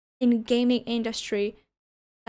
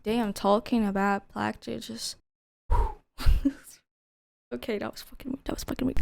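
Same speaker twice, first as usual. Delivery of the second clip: normal talking voice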